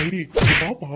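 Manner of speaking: a loud call across the house; man shouting for Heidi to come. Acted. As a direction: call her over from a distance. Husband calls sister-in-law from afar.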